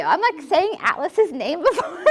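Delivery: laughing embarrassed. Real, not acted. Laughs at blanking on verse.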